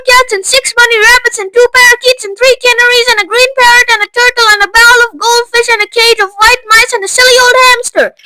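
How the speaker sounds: high, loud bratty tantrum shout; spoilt shout. Acted. Veruca shouts at her mother, bratty tantrum. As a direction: rattle the pets off in a spoilt rage. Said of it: "shouted Veruca".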